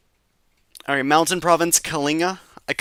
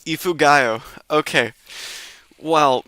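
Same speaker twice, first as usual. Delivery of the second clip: ordinary talk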